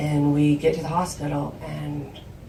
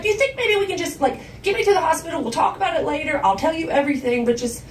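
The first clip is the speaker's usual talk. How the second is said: acted-out desperate daughter; frantic pleading; 10 semitones higher, 4 dB louder than usual. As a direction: plead in a breathless rush of words. Daughter begs to be driven.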